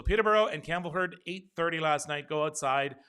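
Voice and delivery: rallying call to Peterborough and Campbellford; emphatic callout. Real, not acted. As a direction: shout out the towns to rally them. Calling out to viewers' towns.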